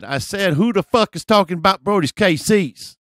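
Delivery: mock belligerent shout; confrontational guy impression. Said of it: "do my best impression of this guy".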